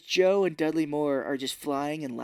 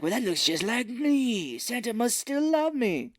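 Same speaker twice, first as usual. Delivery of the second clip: slow, higher dreamy voice; wistful Moore; 7 semitones higher, slower than usual. Retold or imitated. Dudley Moore admiring the carving, slow mock wistful. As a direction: draw it out like a lovesick fool.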